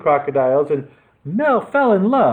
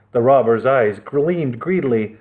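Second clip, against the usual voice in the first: ordinary delivery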